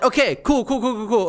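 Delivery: giddy cool. Real, not acted. Riding the win.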